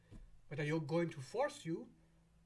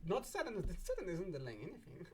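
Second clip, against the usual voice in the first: ordinary delivery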